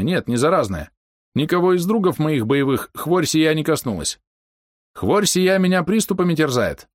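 put-on high, old-fashioned sing-song pleading; folksy storyteller voice. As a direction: spin a folksy excuse. Hero imitates old speech.

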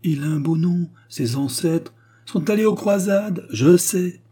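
high-pitched, impatient mocking list; furious interruption. A narrator's reading. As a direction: reel it off in exasperation, pitched well up. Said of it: "interrompit Raymond en tambourinant avec fureur". (énumération moqueuse, voix haut perchée)

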